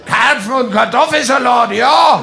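lightly voiced giant dumpling, building to an outburst; talking dumpling with echo. Dumpling scolding the carp in the pot, booming indignantly. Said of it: "haben gesprochen mit Hall".

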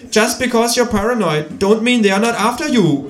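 high-pitched kangaroo quoting English lyrics. Acted. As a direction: deliver the quote high and knowingly.

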